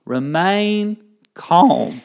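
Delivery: slow, drawn-out Southern drawl; Southern accent. Pretend. Showing co-host how the rule goes, slow Southern accent. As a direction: stretch it out like a Southerner. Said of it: "do it in a Southern accent".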